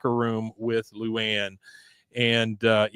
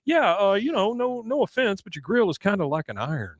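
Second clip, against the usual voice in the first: much higher, prissy put-on voice; high snooty clerk; 8 semitones higher than usual. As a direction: look down your nose at the grill. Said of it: "He goes".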